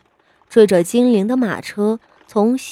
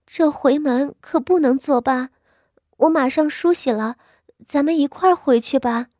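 higher-pitched wife's voice, imploring; pleading; 6 semitones higher than usual. A narrator's reading. Wife begging her husband to go home with her, pleading. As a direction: plead gently to keep the visit on. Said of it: "她声色中透着哀求".

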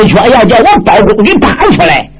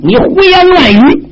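slow, higher indignant outburst; angry retort; 8 semitones higher than usual. Acted. Shen Guqing rebuking Bao Gong in court, slow indignant outburst. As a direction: fling the accusation back slowly.

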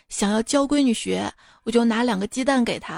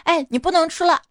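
higher, louder stern yell; sharp shout; 7 semitones higher, 4 dB louder than usual. Retold or imitated. Mother catching her son at the freezer, sharp shout. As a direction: shout a firm stop at him. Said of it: "我就大喝一声".

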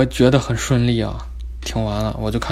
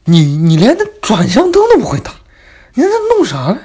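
angry yell; 11 semitones higher, 8 dB louder than usual. Retold or imitated. Driving teacher yells at him.

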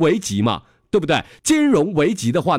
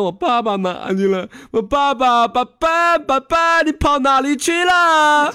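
put-on high, loud child calling out for his dad, stretching it slowly; a little boy lost in the supermarket, calling 爸爸 over and over; 11 semitones higher, 4 dB louder, slower than usual. 孩子在超市喊找爸爸. (小孩拉长声音高声喊爸爸)

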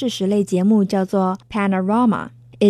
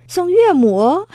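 teasing stretch; 8 semitones higher, 5 dB louder, slower than usual. Real, not acted. Joking aside about mothers-in-law.

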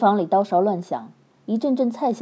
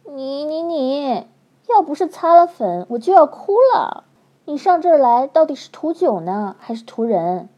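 hurt flirt; 4 semitones higher than usual. A narrator's reading. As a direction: wail like she's about to cry. She nearly cries, hurt.

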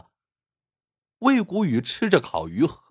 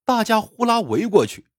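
ordinary delivery

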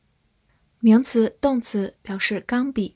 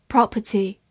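"Property" British-style with silent middle r; British accent. Shown as a demonstration. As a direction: give the British PROP-uh-tee for "Property". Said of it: "美式发音".

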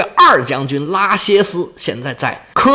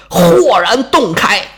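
usual speaking voice

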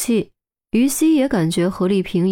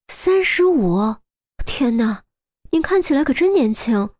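amazed exclamation; 4 semitones higher than usual. A narrator's reading. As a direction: marvel at it with a lifted pitch. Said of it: "于西惊叹地说". (惊叹不已)

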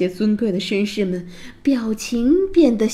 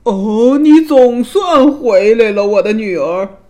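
big-voiced royal bellow; booming king; 9 dB louder than usual. A narrator's reading. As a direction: shout out a hearty welcome as a loud-mouthed king. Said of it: "国王用他的大嗓门喊着".

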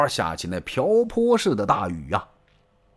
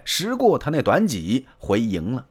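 ordinary delivery

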